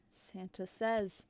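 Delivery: usual speaking voice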